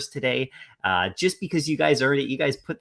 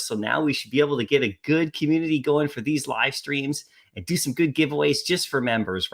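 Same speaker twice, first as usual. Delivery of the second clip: ordinary delivery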